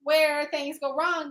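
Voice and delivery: loud frustrated yell; slow, loud outburst at the book. Real, not acted. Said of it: "I did just yell".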